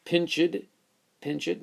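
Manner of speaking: pinched with the id ending, pinch-id. Shown as a demonstration. Teacher testing the wrong id ending on pinched. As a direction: say pinched as two syllables. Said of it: "that's definitely not right".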